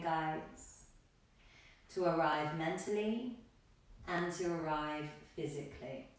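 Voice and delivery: calm, measured yoga-teacher cues; meditative breath instruction; guided breathing. Real, not acted. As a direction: guide them gently through a breath, leaving long pauses. Leads calming breathing in class.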